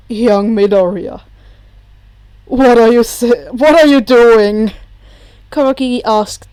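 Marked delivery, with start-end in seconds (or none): 0.1-4.7 startled bartender voice. Acted. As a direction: call out in alarm. Kurogiri calling out, loud.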